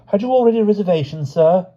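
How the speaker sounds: high, quick, fussy porter asking; a porter's prim high query; hotel porter voice. A narrator's reading. Porter asks the stranger about a reservation, high and quick.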